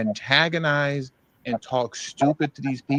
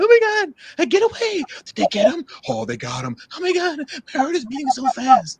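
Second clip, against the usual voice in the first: very high mock panic, louder; mocking panicked voice; 3 dB louder than usual. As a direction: parody the frightened filmer in a high squeal. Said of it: "morons like who we were just listening to".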